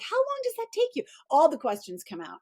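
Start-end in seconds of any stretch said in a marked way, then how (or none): 0.0-1.0 much higher incredulous question; mock the asker's disbelief, pitched up; imitating curious people. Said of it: "They're like".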